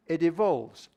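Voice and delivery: ordinary talk, nothing marked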